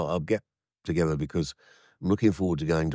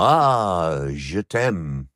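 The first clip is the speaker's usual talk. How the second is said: mock French accent; 5 dB louder, much slower than usual. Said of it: "just doing it with a French accent".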